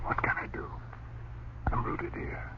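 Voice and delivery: hushed, unvoiced fearful whisper; frozen whisper. Husband whispers that he cannot move. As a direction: whisper in helpless terror.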